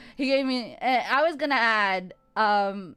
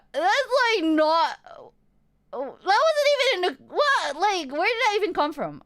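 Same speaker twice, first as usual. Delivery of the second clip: incredulous; 7 semitones higher than usual. Retold or imitated. Incredulous retort, high.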